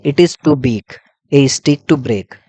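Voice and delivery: slow voiced reading, each word spaced; slow spoken example sentence; slow dictation. Shown as a demonstration. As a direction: read it aloud slowly for notes. Same example sentence repeated voiced.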